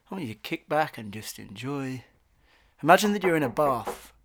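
ordinary talk

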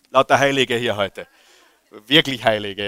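ordinary delivery

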